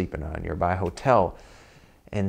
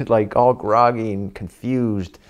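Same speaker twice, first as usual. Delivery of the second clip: ordinary talk, nothing marked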